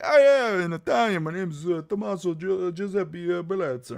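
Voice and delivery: mock showy Italian. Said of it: "you can't be like".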